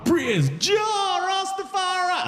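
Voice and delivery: high, drawn-out praise call; high-pitched, stretched-out mock chant; exultant Rastafarian cry.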